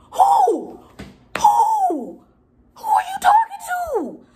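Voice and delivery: high, loud incredulous shriek of who; outraged shriek. Real, not acted. Vlogger outraged the leasing guy cut her off, shrieking. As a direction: shriek it like you cannot believe his nerve.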